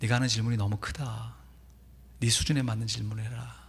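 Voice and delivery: low, soft, gentle voice of God; a quiet still voice correcting him; calm inner voice. Retold or imitated. God answering Carver again, soft and calm. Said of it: "전에 들렸던 음성과 동일하게".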